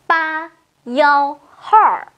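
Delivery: ba幺hai said slowly, digit by digit in Mandarin; Chinese digits. Shown as a demonstration. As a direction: read each digit separately, slowly. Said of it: "số một trăm tám mươi mốt".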